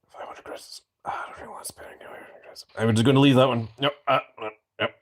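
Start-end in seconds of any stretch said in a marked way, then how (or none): 0.1-2.6 under his breath; whispering. Real, not acted. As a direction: mutter it aside. Muttering to himself.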